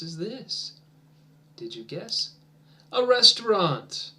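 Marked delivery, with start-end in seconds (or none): 2.9-4.1 higher, louder model answer; model word. Shown as a demonstration. Teacher giving the answer before class repeats, louder. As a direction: announce it brightly for repeating. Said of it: "Repeat after me".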